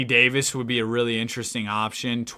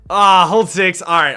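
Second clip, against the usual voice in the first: higher and louder, sudden triumphant yell; real excited shout; excited outburst; 6 semitones higher, 11 dB louder than usual. Real, not acted. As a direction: shout it like the deal just landed. Triumphant moment landing a trade pick.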